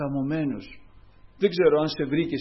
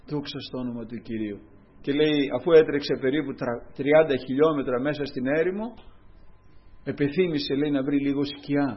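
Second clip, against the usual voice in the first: normal talking voice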